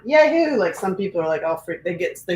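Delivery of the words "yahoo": high, loud "Yahoo!" of fake glee; mock cheer. Mom mocking Halloween fans, a loud whoop. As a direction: whoop it up like a fan. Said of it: "something I'm like".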